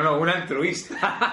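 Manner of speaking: slow words through laughter; laughing. Real, not acted. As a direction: laugh while correcting yourself. Laughing at own slip.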